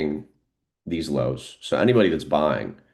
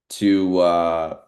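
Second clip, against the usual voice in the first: ordinary talk, nothing marked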